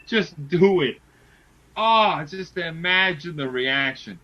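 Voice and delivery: normal talking voice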